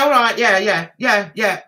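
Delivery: usual speaking voice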